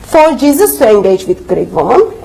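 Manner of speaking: ordinary talk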